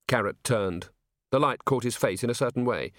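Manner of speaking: usual speaking voice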